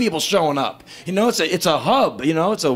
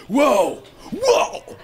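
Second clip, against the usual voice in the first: awed whoa; 8 semitones higher than usual. Retold or imitated. Awestruck at a holy site.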